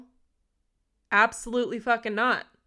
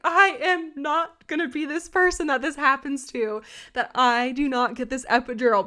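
louder, higher mock-furious insistence; retold defiance; 5 semitones higher, 5 dB louder than usual. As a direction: refuse it like you mean it, half-acted. Angry about missing epidural.

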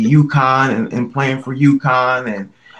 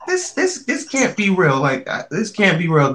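stunned disbelief; 6 semitones higher than usual. Retold or imitated. Friend recalling hearing of Kobe's death, shocked high disbelief. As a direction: pitch leaps way up on the first this, then settles. Retold disbelief at Kobe news.

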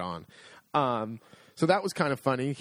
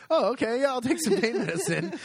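high-pitched, hasty agreement; comically cowed, laughing underneath; meek compliance; 10 semitones higher than usual. Retold or imitated. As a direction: give in at once after a scolding. Gives in after being scolded.

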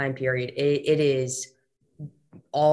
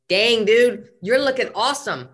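congratulating; 7 semitones higher, 5 dB louder than usual. Real, not acted. Praising client's before and after pictures.

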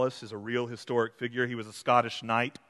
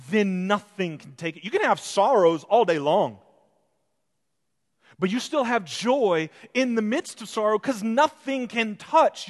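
fervent preaching; 7 semitones higher, 7 dB louder than usual. Acted. Preaching at full force.

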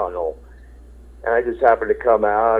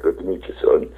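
a lower, softer Good to meet you, son, in a lightly put-on star voice; imitating Charlton Heston; 4 semitones lower, 5 dB quieter than usual. The actor answering the fan, lower and softer mock-dignified voice. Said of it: "He's like".

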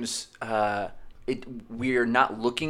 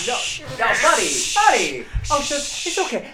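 soothing; 11 semitones higher, 8 dB louder than usual. Pretend. Comforting panicking friend.

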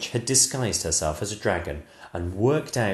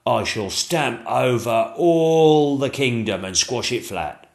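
slow, louder menacing dragon; dragon threat; 3 semitones higher, 6 dB louder than usual. Acted. Dragon threatening the kingdom, slow and menacing. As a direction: drag it out as a threat.

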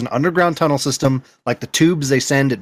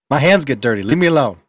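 ordinary talk

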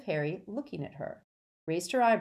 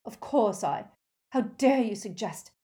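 louder, higher indignant reply; raised voice, affronted; heated; 5 semitones higher, 6 dB louder than usual. A narrator's reading. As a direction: bristle with offence. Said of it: "her voice was heated as she replied".

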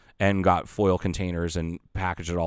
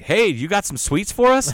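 much higher, louder eager call; eager greeting; 12 semitones higher, 7 dB louder than usual. Retold or imitated. Firefighters at the door greeting the sweets, eagerly. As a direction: greet the sweets delivery with glee. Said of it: "and they're like".

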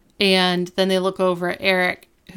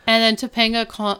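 ordinary delivery

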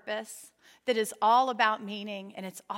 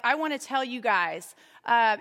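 ordinary delivery

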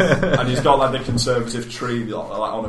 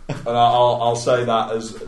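usual speaking voice

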